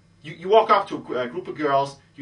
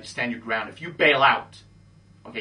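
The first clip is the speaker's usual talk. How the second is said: ordinary delivery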